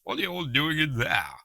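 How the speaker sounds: loud, high-pitched mock-posh English; frightfully posh accent. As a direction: call out like a posh English gentleman. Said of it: "he's frightfully posh".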